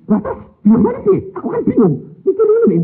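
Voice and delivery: high, loud confused outburst; flustered. Retold or imitated. Father puzzles over the wedding plan, high and loud. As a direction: sound totally confused. Baffled retelling.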